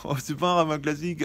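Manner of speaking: amused, laughing dismissal. Retold or imitated. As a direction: laugh it off lightly. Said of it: "des gens qui disent".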